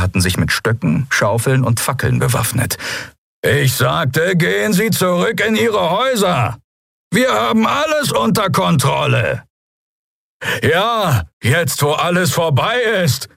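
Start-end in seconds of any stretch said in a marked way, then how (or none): 3.4-9.4 high, forceful captain's bellow; shouted command. A narrator's reading. Captain sends the citizens home, shouting. 10.6-13.3 high-pitched call from a crowd; heckling shout. A narrator's reading. Draxyl citizen heckles the captain, calling out. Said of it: "rief jemand aus den Reihen".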